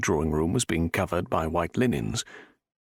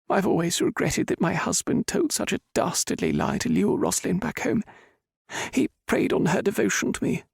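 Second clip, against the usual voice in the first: put-on higher, sorrowful older lady's voice; grieving mother Roberta; 13 semitones higher than usual. Roberta mourns her daughter.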